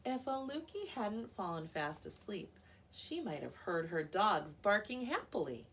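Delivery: ordinary delivery, nothing marked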